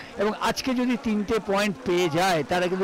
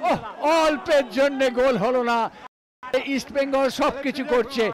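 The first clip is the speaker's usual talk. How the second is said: excited near-miss reaction; 5 semitones higher than usual. Real, not acted.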